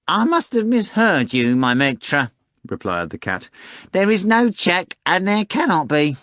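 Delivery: a smug cat boasting it will win; high showy talking cat, sure of victory; pompous cat. Acted. Cat Behemoth's voice.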